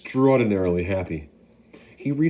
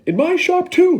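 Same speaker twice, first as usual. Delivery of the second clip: much higher, louder proud yell; 9 dB louder than usual. Acted. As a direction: crow about his shop. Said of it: "he yelled".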